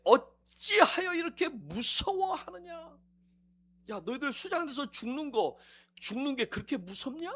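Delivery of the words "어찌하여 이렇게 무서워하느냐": slow rebuke. Acted. Jesus rebukes disciples.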